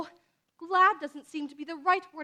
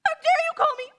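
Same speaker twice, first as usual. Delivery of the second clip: louder and higher, near tears with rage; furious outburst; 6 semitones higher, 5 dB louder than usual. Acted. Orphan girl lashes out at the insulting neighbour, tearful fury. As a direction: hurl it back at her, choking with hurt.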